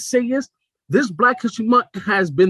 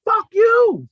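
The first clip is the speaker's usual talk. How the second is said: high, loud yell, imitating her; retold angry yell; 6 dB louder than usual. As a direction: yell it out like the woman on the train. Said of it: "she yelled out".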